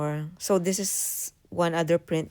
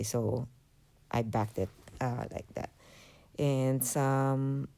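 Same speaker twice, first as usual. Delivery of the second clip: normal talking voice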